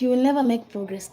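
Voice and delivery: usual speaking voice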